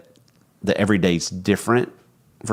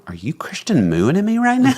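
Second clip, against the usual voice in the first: mock surprise; 11 semitones higher, 3 dB louder than usual. Teasing wife about her hum.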